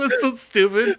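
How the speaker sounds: talking through laughter; laughing. Real, not acted. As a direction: laugh while saying it. Laughing after the joke.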